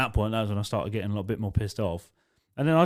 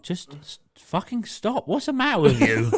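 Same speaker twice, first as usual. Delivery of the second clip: exasperated telling-off; 7 semitones higher than usual. Retold or imitated. Pinning drunk woman at the bar.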